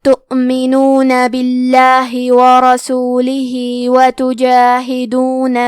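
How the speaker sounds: slow, drawn-out tarteel recitation; Quran recitation. Acted. Teacher recites the verses for students, slow tarteel. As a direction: let every vowel stretch, measured and melodic.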